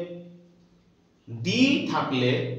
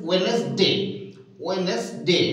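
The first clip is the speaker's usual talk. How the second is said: ordinary delivery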